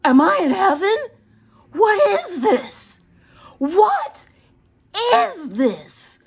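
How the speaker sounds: overwhelmed with delight; amazed. Retold or imitated. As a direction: gasp out your wonder at the swarm. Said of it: "I'm like".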